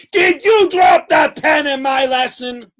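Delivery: high shouted scolding; mock furious teacher shout; teacher yelling. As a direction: bellow it at the kid who dropped the pen.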